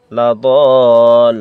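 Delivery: very slow, ringing recitation of লা, দল; four-count madd. Shown as a demonstration. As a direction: drag out দল for four alif counts. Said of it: "উপরে মোটা চিহ্ন".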